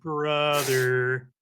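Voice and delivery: slow, drawn-out deadpan; stunned disapproval. Retold or imitated. Friend reacting to the accent attempt, stunned. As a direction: stretch each word in mock disbelief.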